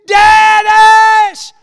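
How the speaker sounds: very high, very loud drawn-out wail; full-force acted groaning yell; wailing cry. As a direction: howl the name in re-enacted anguished prayer. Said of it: "began to cry and make these moans and groans".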